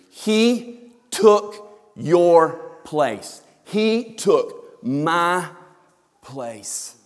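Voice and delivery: each word of "He took your place" stretched out; very slow climactic reading. Acted. Preacher ending the quotation for the congregation, very slow solemn delivery. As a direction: let it land slowly.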